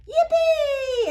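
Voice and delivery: very high, loud cheer; yelled. Acted. Kids cheering about ice skating, loud high yell. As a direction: whoop with joy. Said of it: "everybody yelled".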